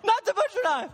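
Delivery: mimicking a toddler's frantic no no; panicked child scream. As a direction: scream like a small boy who thinks the knife is coming. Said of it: "screaming bloody murder".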